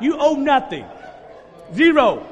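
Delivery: loud, high, laughing praise shouts; preaching at full force. Acted. Pastor shouting joyfully to the congregation about debts zeroed out. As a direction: whip the church up with joy, high and loud. Pastor erupts in celebrating debt freedom.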